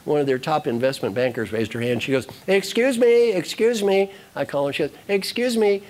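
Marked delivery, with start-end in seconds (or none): 2.5-4.1 high mock call for attention; hammed-up, pitched-up interruption; insistent interrupter. Imitates banker raising hand to interrupt. 5.1-5.8 high mock call for attention; hammed-up, pitched-up interruption; insistent interrupter. Banker interrupts again, imitated.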